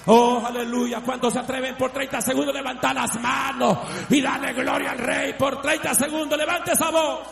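high, loud, fervent testimony; shouting revival preacher; full-force preaching. Acted. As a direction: preach it out with fire to the congregation.

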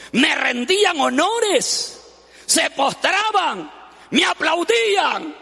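slow, high, swelling with pride; proud boasting donkey. Acted. Preacher voicing the proud donkey to the congregation, slow and boastful. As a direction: puff up like a praised donkey.

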